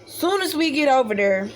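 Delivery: ordinary talk, nothing marked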